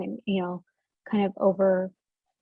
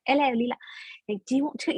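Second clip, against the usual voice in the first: put-on higher-pitched hammy British accent; bad British accent; 4 semitones higher, faster than usual. Author practicing her character's dialogue, bad British accent.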